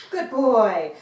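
loud, drawn-out praise with a fall; hearty praise. Real, not acted. Trainer rewarding her dog for a shake, hearty praise.

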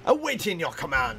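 lightly voiced soldier reporting for orders; mimicking game character. Player parroting the game's unit line, light mimicry. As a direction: echo a game unit's ready line, lightly put on.

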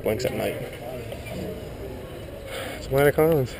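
ordinary delivery

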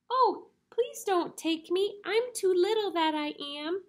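high, pleading tiny goat; very small voice. Acted. Tiniest goat begs the troll, very small voice. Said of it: "in his very small voice".

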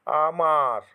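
আমার with the second আ held longer; long second vowel. Shown as a demonstration. Teacher demonstrating vowel length in আমার. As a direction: stretch the মা in আমার. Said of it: "তার থেকে বেশি সময়ের".

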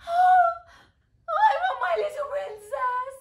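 very high, loud mock wail; hammed-up falsetto cry; wailing queen. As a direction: burst out like an overgrown child. Queen wails for her daughter.

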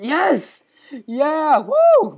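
high, loud whoops of victory; triumphant cheer. Real, not acted. Gamer cheering as he tames the cat, loud whoops. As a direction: cheer like you just won.

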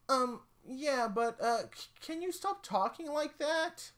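put-on high, soft nervous child; high, soft child asking him to stop; uneasy kid voice. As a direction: squeak it like a weirded-out kid. Kid voice objects to creepy voice.

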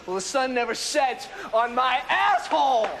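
scornful yelled retort; defiant shout. Acted. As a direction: build to a shouted comeback. Defiant retort to British boast.

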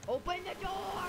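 urgent call to open up. Real, not acted. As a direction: shout the command at the door. Calling out in game.

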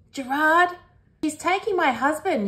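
put-on high, alarmed child's cry; yell. A narrator's reading. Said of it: "yells Bluey".

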